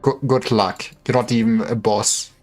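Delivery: ordinary talk, nothing marked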